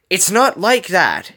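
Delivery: high, loud, drawn-out retort; snapping. A narrator's reading. Gogo denies avoiding her friends, snapping. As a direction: snap back defensively, stretching the words. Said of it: "Gogo snapped".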